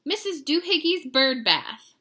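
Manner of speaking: acted mama's rant; scolding mother. A narrator's reading. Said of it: "she scolded".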